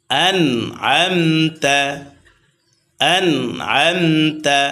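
আনআমতা drawn out very slowly, each sound clear; slow demo. Shown as a demonstration. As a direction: stretch আনআমতা slowly, meem spoken clearly without nasal hum. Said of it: "slow tajweed demo".

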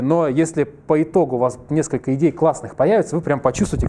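normal talking voice

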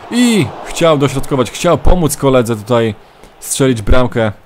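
energetic play-by-play commentator voice; live match commentary. Acted. Mock football commentary of own match.

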